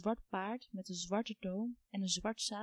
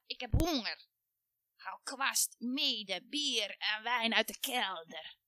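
demanding witch; slower than usual. A narrator's reading. Witch orders the girl.

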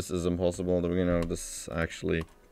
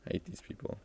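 hushed whisper, no voice; 6 semitones higher, 17 dB quieter, faster than usual. Real, not acted. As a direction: whisper it under your breath. Muttering about annoying callers.

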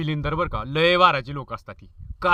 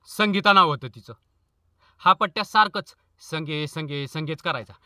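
ordinary talk, nothing marked

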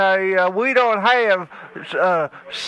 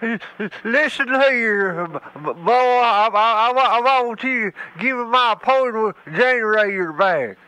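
put-on a crotchety old man who stammers on every word; faster than usual. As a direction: stutter and sputter like an angry old hillbilly. Prank caller's stuttering old man.

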